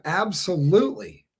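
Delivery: ordinary talk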